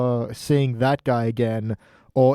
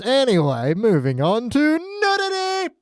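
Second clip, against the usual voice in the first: mock grand announcement; 10 semitones higher, slower than usual. Dramatic segue to topic.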